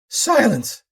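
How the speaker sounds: high, loud furious scream; screamed command. Acted. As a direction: shriek for silence. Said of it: "screamed Fortock".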